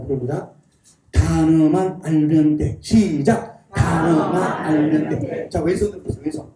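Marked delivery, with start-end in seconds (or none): 1.1-2.8 stretched-out words for repeating; slow group drill. Shown as a demonstration. Leads the audience in slow repetition. 3.7-5.5 stretched-out words for repeating; slow group drill. Shown as a demonstration. Leads the audience in slow repetition.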